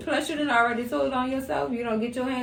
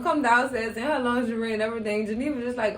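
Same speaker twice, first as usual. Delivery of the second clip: ordinary talk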